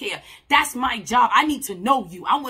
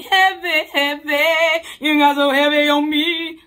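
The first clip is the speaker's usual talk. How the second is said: loud, put-on heavy voice on heavy; mock heavy voice; 5 semitones higher, 9 dB louder, slower than usual. Joking that nobody wants heavy talk, loud put-on voice. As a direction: clown it up to lighten the mood. Mocks being too heavy.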